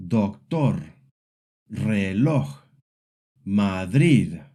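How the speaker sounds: docTOR, reLOJ, maDRID, last syllable strong; doctor, reloj, Madrid stressed at the end, Spanish style; final-syllable stress. Shown as a demonstration. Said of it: "the stress usually falls on the last syllable".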